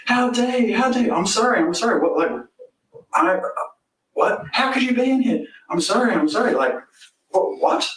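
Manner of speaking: high frantic back-and-forth yelling; mock screaming argument. Neighbour yelling and him apologising, mock frantic. Retold angry confrontation, both voices.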